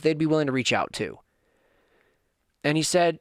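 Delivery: ordinary talk, nothing marked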